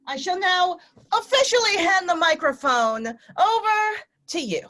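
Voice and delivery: high, loud, mock-ceremonial proclamation; grand announcement. Host handing the floor to SF Shakes, mock-grand proclamation. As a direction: announce it like a herald, with a flourish.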